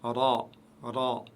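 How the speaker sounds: "Ra" heavy, with one tap and no roll; "ra" said full and dark. Shown as a demonstration. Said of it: "vous la prononcez toujours lourde".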